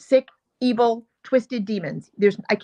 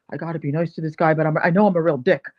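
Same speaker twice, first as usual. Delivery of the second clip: lightly voiced smug rookie, lower and quick; mock the rookie's grudging politeness, a touch lower; imagined inner voice; 5 semitones lower, faster than usual. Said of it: "That's what he looked like".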